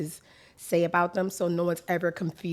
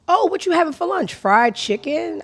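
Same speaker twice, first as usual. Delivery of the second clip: mock question; 10 semitones higher, 7 dB louder than usual. Voicing someone asking about lunch.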